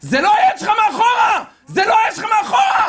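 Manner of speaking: full-force high shout; frantic yelling. Retold or imitated. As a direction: scream the warning at the other driver. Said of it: "צועק לו". (צעקה גבוהה בכל הכוח)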